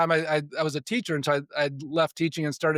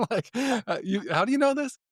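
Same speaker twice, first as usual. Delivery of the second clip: higher, laughing amazement; laughing disbelief; 7 semitones higher than usual. Retold or imitated. Stunned by the psychic's insight, laughing disbelief. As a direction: ask it through a laugh, half stunned.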